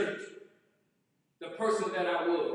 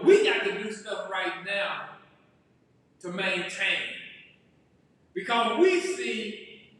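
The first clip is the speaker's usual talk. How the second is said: normal talking voice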